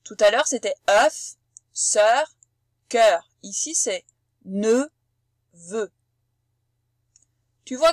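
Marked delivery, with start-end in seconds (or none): 0.9-3.3 louder open vowel in œuf, sœur, cœur; œuf, sœur, cœur spaced out, vowels open. Shown as a demonstration. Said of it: "nous allons les prononcer". 4.4-5.9 nœud and vœu spaced out, vowels closed. Shown as a demonstration. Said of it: "ils ne se prononcent pas pareil".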